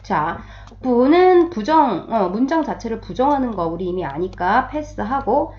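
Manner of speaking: ordinary delivery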